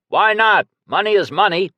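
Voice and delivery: high, loud heckling call; shouting from the crowd. A narrator's reading. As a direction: yell it out over the crowd. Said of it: "Someone in the crowd shouted".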